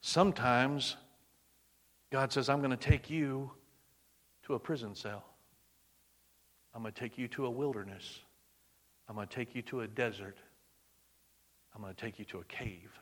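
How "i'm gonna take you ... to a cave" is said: soft voice of God. Retold or imitated. God speaking softly to believer.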